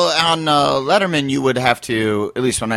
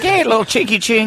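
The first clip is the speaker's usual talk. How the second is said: mock mom voice, pitched high; lightly put-on, higher mom voice; imitating the mom; 7 semitones higher than usual. Guest mimics friend's mom greeting the boys, mock mom voice. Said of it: "She's like".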